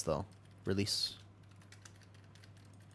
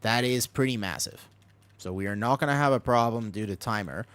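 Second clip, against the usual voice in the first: ordinary talk, nothing marked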